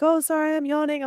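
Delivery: high, light mock apology; a hint of a sheepish apology; apologetic yawner. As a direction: imitate someone sorry for yawning. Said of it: "when people are like".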